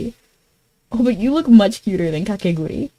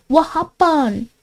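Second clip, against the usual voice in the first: higher, drawn-out mock question; playful put-on voice; 6 semitones higher, much slower than usual. Streamer repeating her question to a viewer, playful put-on voice. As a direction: stretch it out in a silly higher voice.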